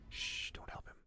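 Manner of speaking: soft unvoiced whisper. Real, not acted. Telling chat not to help him, in a whisper. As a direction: hush them in a whisper. Hushing chat mid-argument.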